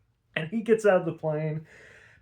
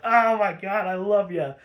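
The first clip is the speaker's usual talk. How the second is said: loud, higher mock adoration; lightly hammed-up lovestruck exclamation; gushing; 4 semitones higher, 5 dB louder than usual. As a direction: act out a delighted villain in love. Joker gushes after failed killing.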